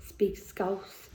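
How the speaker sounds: breathy unvoiced whisper of the line; whispering the city's name for her speech. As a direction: hush it right down, almost secret. Poem's closing echo, whispered.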